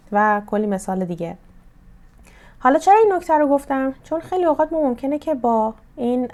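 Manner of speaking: usual speaking voice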